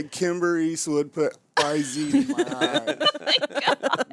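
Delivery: slow, laughing, high proud brag; starstruck boast. Retold or imitated. Guest imagines bragging at home about the makeup artist, slow laughing. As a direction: savour the bragging line. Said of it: "I can go home and say".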